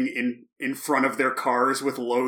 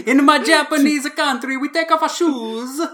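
mock Japanese accent; 14 semitones higher, 8 dB louder than usual. Joins the mock accent bit.

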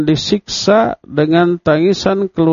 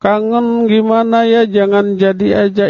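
put-on higher, pleading voice of a parent about to miss their child; crying parent; 7 semitones higher than usual. Parent hugging the departing child, higher, pleading and tearful. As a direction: beg the child not to go. Orang tua itu nangis memeluk kita.

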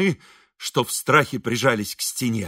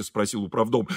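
ordinary delivery, nothing marked

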